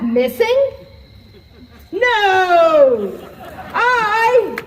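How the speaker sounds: loud, high shout of shock; alarmed cry. Acted. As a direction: cry out in disbelief. Discovers staff missing.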